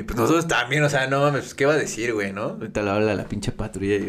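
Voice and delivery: ordinary talk, nothing marked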